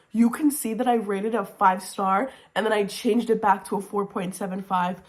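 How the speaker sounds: ordinary delivery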